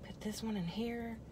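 soft unvoiced whisper; whisper to self. Real, not acted. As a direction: whisper it quietly while fiddling. Muttering while assembling.

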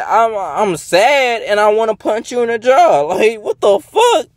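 squeaky furious disbelief; outraged high shout. Real, not acted. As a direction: voice shooting way up, loud and incredulous. Outraged rant, voice shoots up, shouting.

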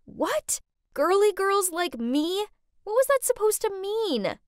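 high-pitched, offended questioning; affronted, without much added volume; indignant shouting back. A narrator's reading. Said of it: "I shouted back".

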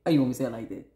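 acted low, level demand; low-voiced take. Shown as a demonstration. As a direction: drop it low, falling at the end.

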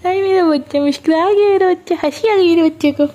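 very high, cooing delight; delighted baby talk. Acted. Mother delights as her baby finally smiles, cooing high. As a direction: gush over the baby's smile.

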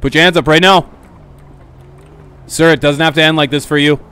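loud, stern police orders; shouted commands. Acted. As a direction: bark the commands at the suspect. Cop commanding suspect.

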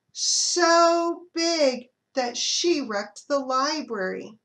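drawn-out storybook emphasis; big emphasis. A narrator's reading. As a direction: lean hard on so big. Building emphasis on elephant size.

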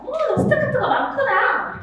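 high, sweet teacher voice with a laugh; mock teacher sweetness. Shown as a demonstration. Teacher teasing a pupil's face, high and sweet. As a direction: gush at the child playfully. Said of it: "이렇게 하는 거야".

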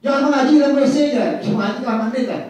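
loud, high telling-off; acted parental scolding. Father forbids child reciting in the bathroom, loud acted scolding. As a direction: lay down the rule as an indignant father.

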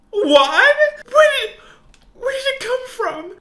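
high, loud shocked cry; can't believe what she hears; stunned mother's outburst; shocked disbelief. Acted. Mother hears daughter is incurable.

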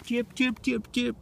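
very high, soft bird chirps; chirping like a bird. Pretend. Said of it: "when I chirp".

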